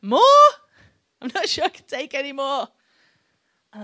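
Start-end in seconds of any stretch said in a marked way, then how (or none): 0.0-2.7 exasperated disbelief. Retold or imitated. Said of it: "I'm like".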